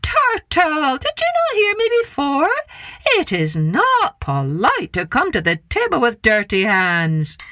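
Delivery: high indignant scolding, then slow; trickster tale voices. Acted. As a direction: lecture him with mock outrage. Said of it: "Anansi yelled at him".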